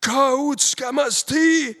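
very high, loud, drawn-out cry; warriors' cry. A narrator's reading. Enemy warriors recognising the hero, high drawn-out cry. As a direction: cry the name out long and high. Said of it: "riefen die Indsmen zaudernd".

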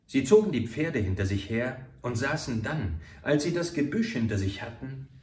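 plain reading voice

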